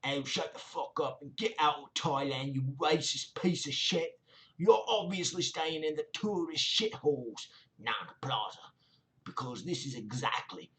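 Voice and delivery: a hammed-up South London accent mocking the commenter. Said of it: "I'm gonna do my best South London accent".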